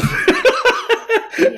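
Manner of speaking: a loud, high laugh at himself; sheepish high laugh; high burst of laughter. Real, not acted. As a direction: crack up at your own mistake. Laughs after saying he effed up.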